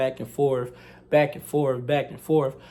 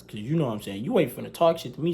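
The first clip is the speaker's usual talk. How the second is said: ordinary delivery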